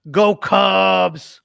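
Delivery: loud, drawn-out fan cheer; rallying cheer. Real, not acted. Guest signing off before the series, rallying cheer. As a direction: send it off as a big team cheer.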